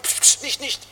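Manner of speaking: hushed, voiceless shushing; shushing whisper. Retold or imitated. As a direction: hush him like the disciples. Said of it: "Da kommen die Jünger, Psst". (zischendes Flüstern der Jünger)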